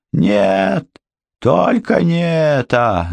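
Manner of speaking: high, slow terrified wail; howling like a cornered animal. A narrator's reading. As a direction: howl like a trapped beast. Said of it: "завыл Полуин, словно загнанный зверь".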